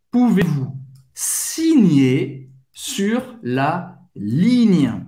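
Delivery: Pouvez-vous signer sur la ligne, slow and spaced; slow drill; slow careful take. Shown as a demonstration. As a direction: say it slowly first. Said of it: "acelerar um pouquinho".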